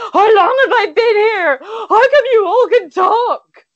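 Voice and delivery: very high, loud hysterical wail; frantic shriek. Acted. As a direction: shriek it like a deranged lost tramp. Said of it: "grabs onto your lapels and shrieks".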